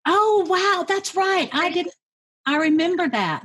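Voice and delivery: high-pitched oh wow of real surprise; surprised realization. Real, not acted. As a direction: catch yourself remembering, pitched well up. Host realizes her mom came first.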